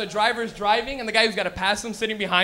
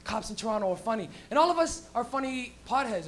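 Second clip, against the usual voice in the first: ordinary talk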